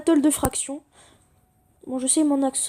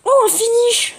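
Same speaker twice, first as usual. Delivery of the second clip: excited; 8 semitones higher, 7 dB louder, slower than usual. Real, not acted. Surprise finisher in fight.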